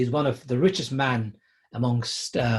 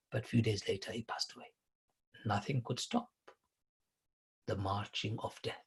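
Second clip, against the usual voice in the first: hushed and grave; 2 semitones lower, 13 dB quieter than usual. Real, not acted. Preacher telling of rich man's death.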